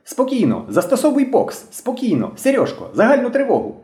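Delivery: put-on high-pitched boy's battle cries; excited shouting. A narrator's reading. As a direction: call out orders high and urgent, without real loudness. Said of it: "кричав білобрисий".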